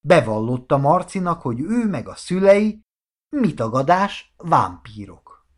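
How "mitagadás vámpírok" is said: ordinary delivery, nothing marked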